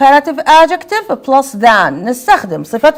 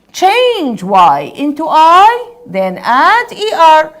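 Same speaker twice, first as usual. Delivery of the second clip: slow dictated rule; 3 semitones higher, much slower than usual. Shown as a demonstration. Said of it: "teacher dictating the spelling rule".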